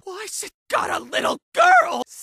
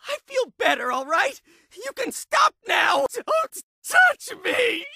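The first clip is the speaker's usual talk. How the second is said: agitated patient lashing out at voices; frantic, erratic outburst; tormented, deranged shouting. Acted. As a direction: shout as if cornered and losing your mind.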